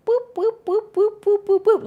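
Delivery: high, quick-fire boops; little bouncy "boop" sounds; small boop noises. Retold or imitated. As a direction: imitate a tiny thing booping along. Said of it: "like that".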